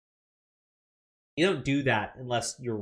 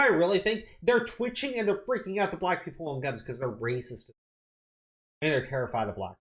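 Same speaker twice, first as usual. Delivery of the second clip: ordinary delivery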